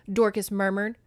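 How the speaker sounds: usual speaking voice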